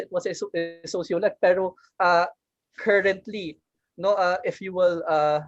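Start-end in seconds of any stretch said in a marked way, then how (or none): none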